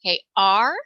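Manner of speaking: ordinary talk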